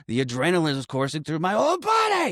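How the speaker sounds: imitated outburst climbing high at the end; mock adrenaline rush. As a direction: build to a hammed-up cry on the last words. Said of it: "little tiny outburst from the adrenaline".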